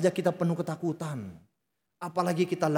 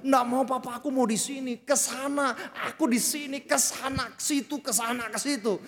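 put-on higher, excited little kid refusing; childish insistence, pitched higher; whiny child; 7 semitones higher than usual. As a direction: bounce from shelf to shelf like a child. Child refuses, pointing at toys.

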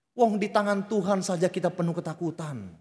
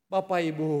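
normal talking voice

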